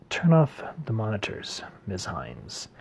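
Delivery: unvoiced, shaken murmur to his assistant; shaken whisper. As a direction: hush it out, stunned. Shaken professor ends the session.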